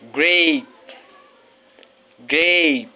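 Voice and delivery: "Grape" with a long, drawn A; long A word. Shown as a demonstration. Teacher names a picture of grapes. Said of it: "That's a long A sound".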